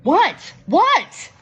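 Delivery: high, loud yelled question. A narrator's reading. Kitty can't hear the whisper and yells back loudly. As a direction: shout it, she can't hear. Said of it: "yells Kitty".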